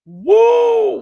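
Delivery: high, loud "whoa"; whoop. Real, not acted. Streamer recovering from a laughing fit, loud whoop. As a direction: let out a long falling whoop.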